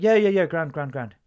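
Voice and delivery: quick-fire Irish reassurance, starting high and loud; Irish accent. Retold or imitated. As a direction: imitate an Irishman brushing off a question. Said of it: "Okay, Irish".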